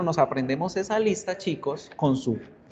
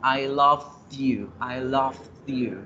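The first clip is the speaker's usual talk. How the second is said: loved said slowly, with a t ending stressed; past tense stressed; much slower than usual. Shown as a demonstration. Ex tells a former love it is over, slow stress on loved. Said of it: "Te amaba, ya no".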